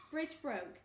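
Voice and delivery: plain reading voice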